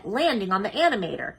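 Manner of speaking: plain reading voice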